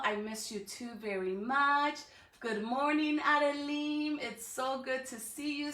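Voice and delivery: plain reading voice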